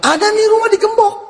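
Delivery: high-pitched sudden exclamation; acted eureka cry; exclaimed discovery. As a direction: call it out high, as if just spotting it. Acting out spotting a padlocked house.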